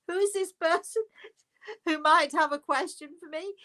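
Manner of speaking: high, laughing tease; squeaky-high amusement through the words; giggling. Real, not acted. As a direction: barely hold the laughter in. Giggling while teasing the co-host.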